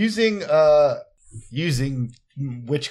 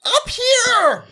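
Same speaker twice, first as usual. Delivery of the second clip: high, loud squeaky cry; a squeaky cat yelling from up high; cat calling out; 5 dB louder than usual. Acted. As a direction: call out high from above like a small cat. Cat calls out from above.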